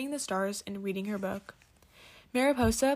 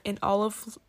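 ordinary delivery, nothing marked

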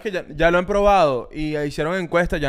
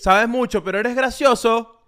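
mocking put-on voice; 7 semitones higher, 4 dB louder, slower than usual.

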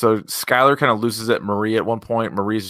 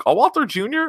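a much higher, incredulous question; mock surprise. Host replying to radio colleagues with mock surprise. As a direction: feign shock at the suggestion. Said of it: "I'm like".

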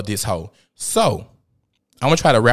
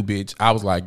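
normal talking voice

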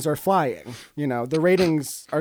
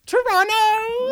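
high, stretched-out Toronto; 3 dB louder, much slower than usual. Pretend. Proud Canadian reveal.

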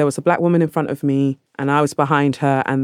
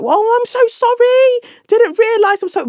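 much higher, louder mock-sorry voice; mock apology; 5 dB louder, slower than usual. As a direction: ham up the women's flustered apology, slow. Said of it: "the women were like".

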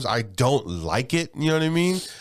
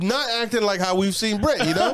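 ordinary talk, nothing marked

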